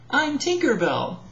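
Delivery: Tink's voice. Acted. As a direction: introduce yourself as Tink. Tink introduces herself.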